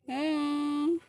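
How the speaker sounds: ordinary talk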